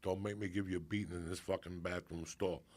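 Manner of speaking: low, contained imitation of a threatening mom; warning mother. Mother cornering son in bathroom stall, low mock warning.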